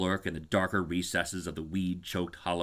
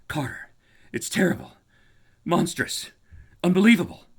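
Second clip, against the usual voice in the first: higher and higher, building to a cry; frenzied terror; 9 semitones higher, 6 dB louder than usual. Acted. Warren calling up from the vault, frenzied. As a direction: panic rising word by word. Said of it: "their frenzied tones again".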